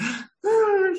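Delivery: imitated child's gasp before crying; high gasp of a child about to cry. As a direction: mimic a little girl bursting into tears. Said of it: "she started crying".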